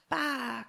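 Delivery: lamb cry. Acted. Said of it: "cried the little lamb".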